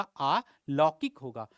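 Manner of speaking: अलौकिक said slowly and carefully, stretching the आ; slow corrected pronunciation. Shown as a demonstration. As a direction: drag out अलौकिक syllable by syllable to show the right form. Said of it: "तो अलौकिक नहीं होगा". (अलौकिक को धीरे-धीरे, साफ़ और खींचकर बोलें)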